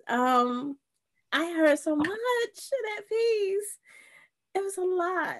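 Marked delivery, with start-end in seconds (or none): 1.3-5.4 moved to tears, barely holding it; real tearful awe. Real, not acted. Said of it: "I got emotional".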